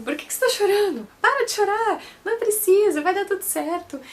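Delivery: imitando o médico, bem mais agudo e alto; médico impaciente. Retold or imitated. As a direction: arremedo do médico mandando parar de chorar. Médico imitado mandando parar de chorar. (imitando o médico impaciente, mais agudo e alto)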